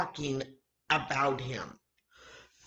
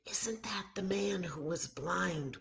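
unvoiced hushed question; whisper; 4 semitones higher, 5 dB quieter than usual. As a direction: whisper it so others can't hear. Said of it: "they began to whisper".

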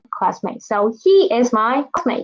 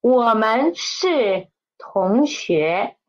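slow model reading; much slower than usual. Shown as a demonstration. Teacher modelling the sentence for students, slowly. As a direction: read 我们是同学 slowly and clearly. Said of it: "So this sentence is".

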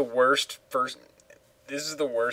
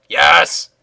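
loud burst of triumph; excited shout; 2 semitones higher, 12 dB louder than usual. Real, not acted. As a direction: cheer it out loud. Reacting to winning in game.

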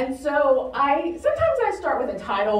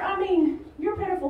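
ordinary talk, nothing marked

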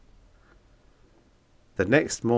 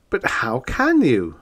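protesting; 5 semitones higher, 5 dB louder than usual. Acted. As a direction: push back in protest. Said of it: "I protested".

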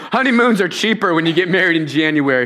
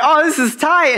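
high, bright-eyed thrill; a young girl thrilled at the news; excited Mary; 9 semitones higher, 4 dB louder than usual. Retold or imitated. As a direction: gush like a thrilled teenager. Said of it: "she's excited".

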